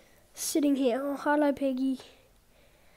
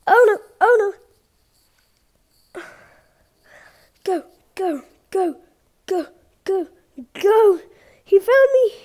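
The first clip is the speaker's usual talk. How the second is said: panicked, rising to a yelp; scared and running for it; being chased; 4 semitones higher, 8 dB louder than usual. Real, not acted. Found by seeker, fleeing.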